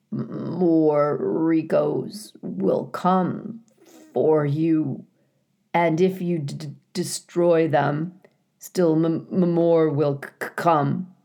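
slow, stuttering broken machine; garbled robot voice. A narrator's reading. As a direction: stammer like a robot shutting down. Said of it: "spoke in a quiet, garbled voice".

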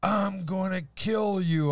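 high, slow mock threat to kill; imitated threat. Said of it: "She's like".

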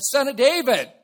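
high, very loud cry across a crowd; full-voiced yell. Blind man calling to Jesus over the crowd, yelling. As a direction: yell it out to get his attention. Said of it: "And he yelled".

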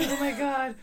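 breathless tearful laughter; gasping out words while crying laughing. Real, not acted. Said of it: "I can't breathe and I'm crying".

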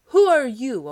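loud hail to the giant; call out. Acted. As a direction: call up boldly to a huge figure. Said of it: "called out to him".

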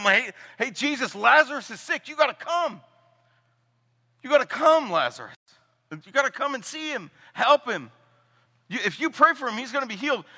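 high, pleading call for help; urgent plea. Acted. Sisters begging Jesus to come heal Lazarus, high and urgent. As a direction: beg him to come now, high and urgent.

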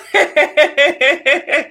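long, giggly laugh; amused laugh. Real, not acted. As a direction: laugh it out after the greeting. Laughs after greeting secret watchers.

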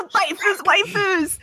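high, slow, delighted; excited anime fan. Real, not acted. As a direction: savor the phrase with glee. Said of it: "Please make a video".

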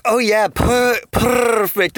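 high feline purr on the R. Acted. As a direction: pitch it up and trill the R.

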